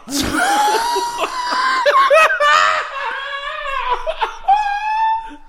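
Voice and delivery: high shrieking giggle; squeaky gleeful gremlin giggle. Real, not acted. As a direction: squeal with helpless laughter. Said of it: "sound like a gremlin".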